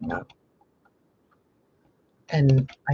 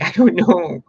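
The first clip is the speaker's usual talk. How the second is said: flustered answer; 4 semitones higher, 8 dB louder than usual. Retold or imitated. Friends ask where she went.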